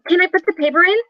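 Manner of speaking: put-on high, eager child voice; little girl. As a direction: ask mom like a four-year-old. Little daughter asks mother.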